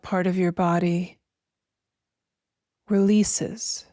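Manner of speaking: slow, spacious meditation voice with long pauses; guided meditation. Acted. Host guiding listeners to feel breath and weightless arms, slow meditation voice. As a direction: guide them gently through each breath, drawing words out.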